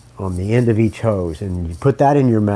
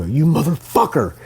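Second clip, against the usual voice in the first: sharp angry cry, pitched higher; cursing a lobster; 6 semitones higher than usual. Retold or imitated. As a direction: swear at the lobster that got away. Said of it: "I go".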